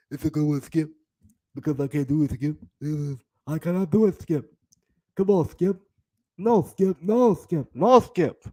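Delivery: imitated sports pundit protesting; Shannon Thorpe. Host as pundit refusing Skip, imitation voice. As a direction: plead with your co-host in a mock pundit voice. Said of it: "I'm Shannon Thorpe".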